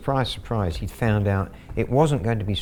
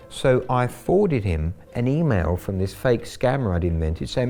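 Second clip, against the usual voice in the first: usual speaking voice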